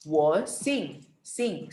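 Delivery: "Xing" pronounced like English "sing"; teacher's clear "Sing" for Xing. Shown as a demonstration. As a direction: model "Wo Xing" with an S sound, like "sing". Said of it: "X jadi S sama mereka".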